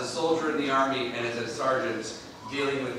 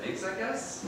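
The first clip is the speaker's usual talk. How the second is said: awkward smile; 9 semitones higher, 7 dB quieter, slower than usual. Retold or imitated. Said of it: "with the most awkward smile he could muster".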